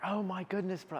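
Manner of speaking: put-on high, excited South African accent. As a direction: imitate a hyped South African gym bro. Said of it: "I don't know what his accent is".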